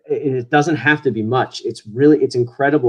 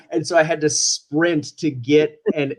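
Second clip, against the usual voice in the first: normal talking voice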